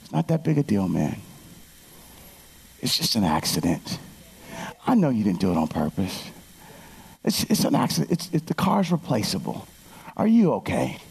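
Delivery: put-on calm a nervous kid down gently; reassuring calm. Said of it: "I'm going".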